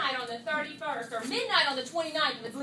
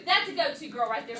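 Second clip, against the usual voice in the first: normal talking voice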